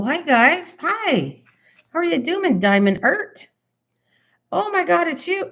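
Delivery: high, delighted hellos; light up at seeing friends arrive; squealing happy welcome; excited greeting. Real, not acted. Greeting arriving raiders on stream.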